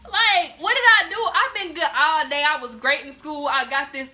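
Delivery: outraged rant. Retold or imitated. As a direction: let the outrage climb into a yell. Outraged at being blamed unfairly.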